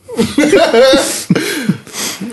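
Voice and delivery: high burst of laughter. Real, not acted. Game master bursting into high laughter at the scene.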